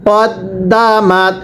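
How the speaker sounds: কদ্দামাত drawn out slowly, short ক then doubled দ্দ; slow spelled-out Quran reading. Shown as a demonstration. Teacher models কদ্দামাত slowly for the class. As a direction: stretch each sound of কদ্দামাত, doubling the দ. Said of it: "যদি আপনি এটাকে কাদ্দা পড়েন তাহলে কিন্তু আর আপনার পড়াটা সহি".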